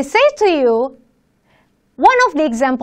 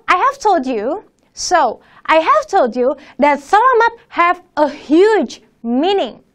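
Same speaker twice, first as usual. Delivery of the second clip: usual speaking voice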